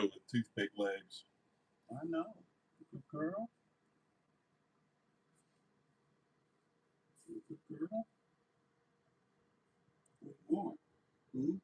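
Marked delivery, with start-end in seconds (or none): 1.9-11.6 much higher and softer pet praise. Real, not acted. Owner praising the little dog in soft, high baby talk. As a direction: coo it sweetly to a good dog.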